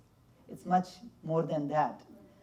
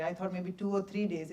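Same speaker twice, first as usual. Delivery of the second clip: ordinary talk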